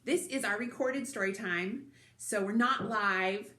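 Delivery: ordinary delivery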